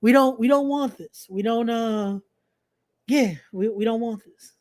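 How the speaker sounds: ordinary talk, nothing marked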